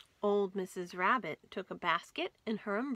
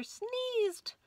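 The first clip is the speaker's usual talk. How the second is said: slow, much higher lead-in to a sneeze; sneeze build-up. A narrator's reading. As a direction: stretch it as the sneeze comes.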